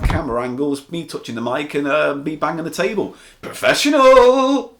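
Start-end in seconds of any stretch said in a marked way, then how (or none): 3.4-4.7 high, loud and long drawn out; mock boast. Host mocking his own bad camera work, sung-out boast. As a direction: sing it out in mock pride.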